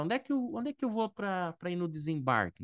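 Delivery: high-pitched quoted traveller asking the way; Brazilian traveller's question. Retold or imitated. Teacher quoting a Brazilian traveller, high and questioning. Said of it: "a gente fala".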